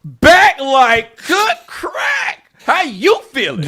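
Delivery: huge high-pitched mock celebration, words stretched out; over-the-top crowing at full volume; gloating shout. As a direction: holler it triumphantly, hammed-up. Acting out gloating reply to stranger.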